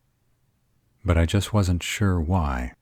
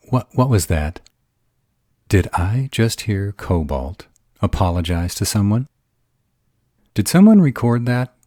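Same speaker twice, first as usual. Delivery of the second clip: rising, louder and louder teasing delight; crowing with mock astonishment; gleeful disbelief; 6 semitones higher, 6 dB louder than usual. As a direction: pounce on the apology, thrilled. [name] gleefully teasing Cobalt's apology.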